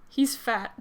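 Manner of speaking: ordinary delivery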